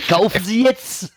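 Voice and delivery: hoch und langsam angepriesen; überdrehter Verkäufer im Teleshopping; Walter Freiwald. Retold or imitated. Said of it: "wie Walter Freiwald oder was der da gerade präsentiert". (Teleshopping-Moderator, hoch und gedehnt)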